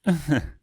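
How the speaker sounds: villain's derisive laugh; scornful laugh. A narrator's reading. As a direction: laugh in his face. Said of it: "threw back her head and laughed".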